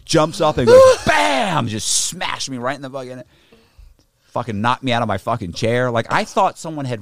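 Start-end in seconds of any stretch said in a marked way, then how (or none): 1.1-1.7 high, loud shouted "Bam"; shouted impact. Retold or imitated. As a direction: yell it like a punch landing. Sound of the punch.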